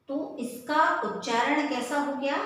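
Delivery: usual speaking voice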